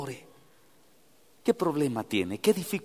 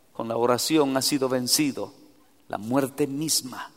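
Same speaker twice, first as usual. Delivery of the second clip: normal talking voice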